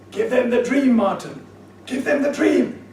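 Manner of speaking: loud, high heckle, building with each repeat; shouted urging. Retold or imitated. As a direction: imitate a singer shouting urgently at the speaker on stage. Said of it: "She's shouting at him".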